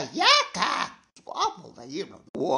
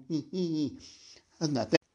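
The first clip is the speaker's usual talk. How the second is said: ordinary talk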